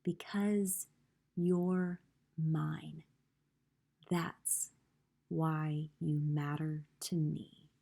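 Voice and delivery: slow, soft and tender; very slowly. A narrator's reading. Eli telling Punchinello why he matters, very slow and soft. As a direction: let each word land slowly. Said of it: "spoke very slowly".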